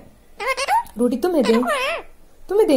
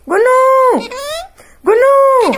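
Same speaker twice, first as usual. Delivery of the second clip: high, loud sing-song call; 12 semitones higher, 8 dB louder, slower than usual. Acted. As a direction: call the pet's name across the room. Calling pet's name out. (पालतू को नाम लेकर ऊँची, तेज़ आवाज़ में पुकारना)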